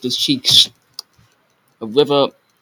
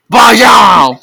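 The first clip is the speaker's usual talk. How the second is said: ordinary delivery, nothing marked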